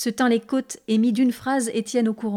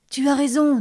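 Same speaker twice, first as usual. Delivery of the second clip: plain reading voice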